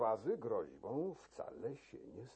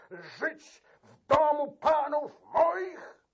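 indignant outcry; 9 semitones higher than usual. Acted. Key-keeper protesting to the count, high indignant outcry. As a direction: shame the count into action. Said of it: "zawołał klucznik".

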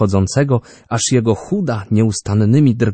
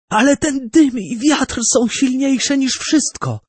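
high, fervent outcry; exclaimed. A narrator's reading. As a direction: cry out in obsession. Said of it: "zakrzyknął Orbano".

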